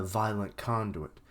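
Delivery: ordinary talk, nothing marked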